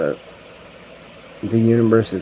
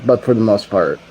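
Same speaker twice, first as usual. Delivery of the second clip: ordinary delivery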